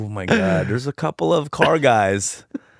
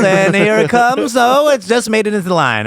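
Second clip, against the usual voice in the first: home run call; 9 semitones higher, 5 dB louder than usual. Pretend. Mock play-by-play home run call.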